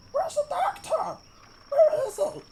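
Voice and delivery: very high, frantic gnome voice; a tiny gnome calling out in panic; wild shouting gnome. Acted. Alvin the gnome calls wildly for the doctor, very high. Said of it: "shouting, wildly".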